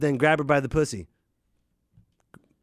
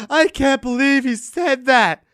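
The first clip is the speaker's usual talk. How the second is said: mock shock; 12 semitones higher, 7 dB louder, slower than usual.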